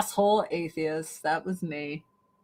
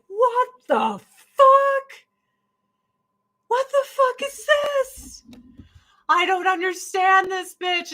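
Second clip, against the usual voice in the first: very high, drawn-out mock disbelief; baffled foreign caller; 11 semitones higher, slower than usual. Overseas caller hearing a casual American agent, mock high-pitched bafflement. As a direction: put on a squeaky, slow, bewildered caller voice.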